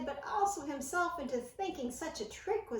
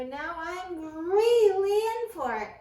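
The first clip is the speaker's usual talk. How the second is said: dread; 4 semitones higher, 10 dB louder, slower than usual. A narrator's reading. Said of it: "mumbled Christopher".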